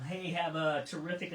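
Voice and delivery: ordinary delivery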